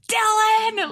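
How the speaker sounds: high-pitched yell of a name; reenacted scream. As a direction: call out as if bursting through the door. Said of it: "as I'm screaming".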